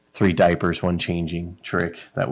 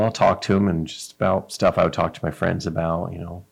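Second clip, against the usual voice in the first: ordinary talk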